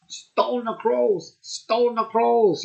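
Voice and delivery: high, very slow drawn-out catchphrase; imitating Simon. Vlogger mimics a beer reviewer's catchphrase, high and slow. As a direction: stretch each word out like a famous catchphrase. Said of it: "he goes".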